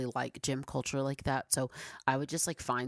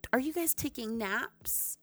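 higher, gasping surprise; mock-shocked question; 10 semitones higher than usual. Real, not acted. To viewers about naps, higher mock-shocked question. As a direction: ask like it's scandalous news.

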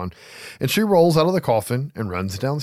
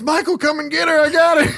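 high, loud mock taunting call; shrill-pitched imitation yell, on the edge of laughing; hammed-up yell; 5 dB louder than usual. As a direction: holler it high, like calling out to a killer.

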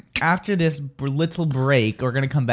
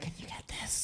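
breathless whisper, no voice; 20 dB quieter than usual. Retold or imitated. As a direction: whisper it so the waitress can't hear. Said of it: "I, like, whispered to my friend".

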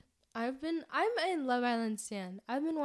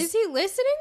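sudden high, loud alarm; 10 semitones higher, 10 dB louder, much faster than usual. Real, not acted. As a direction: gasp it out as if caught. Sudden alarm about classmate.

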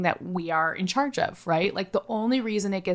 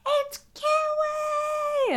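put-on high, slow, drawn-out child's cry of delight; excited kid shout; much slower than usual. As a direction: call the name like a thrilled little boy. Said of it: "he was like".

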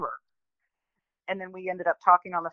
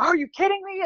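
much higher, stunned disbelief; incredulous boss; 13 semitones higher than usual. Retold or imitated. Boss hearing the story, much higher in disbelief. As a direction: toss it up high like you can't believe it.

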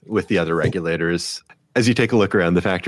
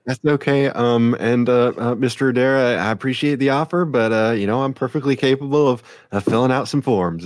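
higher, courteous officer voice; officer Kara, politely declining; 6 semitones higher than usual. Acted. Game master plays the officer Kara.

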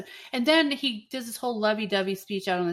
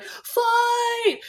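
high yelled call to the restaurant; calling out fight. Retold or imitated. Tom running into the restaurant, yelling to everyone. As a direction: shout to rally the crowd.

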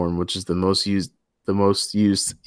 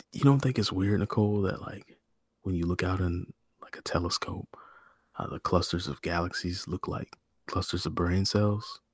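woo Nicole in bed, hushed; pillow talk; 2 semitones lower, 9 dB quieter than usual. Pretend. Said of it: "Pillow Talk in the Articles".

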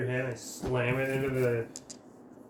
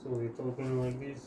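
put-on softer Russian-accented hamster; 3 semitones lower, 8 dB quieter than usual. Player guessing how Russian hamsters talk, soft Russian accent. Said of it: "They're actually Russians".

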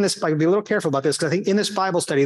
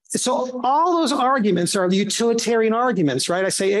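ordinary talk

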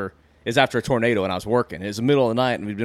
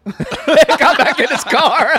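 slow, high laughing; 8 dB louder, much slower than usual. Real, not acted. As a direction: laugh through it. Laughing hard.

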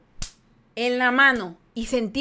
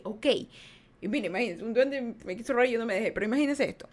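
usual speaking voice

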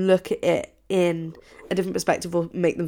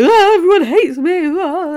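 much higher and louder, whining; hammed-up self-pity; 12 semitones higher, 9 dB louder than usual. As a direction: wail it like a mock tragedy. Quoting herself sulking in the hole.

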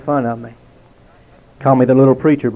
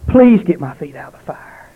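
louder, higher anguished cry of a dying man; retold scream; 4 semitones higher, 5 dB louder than usual. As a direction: cry it out in terror, not a full scream. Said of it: "he screamed".

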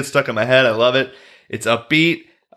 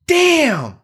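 high-pitched, mock whiny "damn"; exaggerated too-high impression of a curse; Raphael. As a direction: squeal the word way up high, then let it fall. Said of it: "like, higher pitched".

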